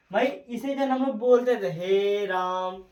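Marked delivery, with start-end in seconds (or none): 1.7-2.8 हे राम slowly stretched out; prolonged vowels. Shown as a demonstration. Teacher recalling how we used to say it, हे राम drawn out. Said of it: "इस type से बोलते रहते हैं".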